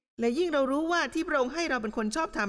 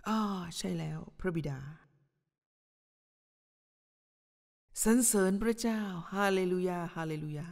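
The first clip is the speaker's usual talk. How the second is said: mock showy prayer; 3 semitones lower than usual. Preacher mocking a showy churchgoer's prayer, hushed and hammed-up. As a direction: sound like you're praying to impress the room. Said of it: "ทำเสียงแปลกๆ เวลาอธิษฐา".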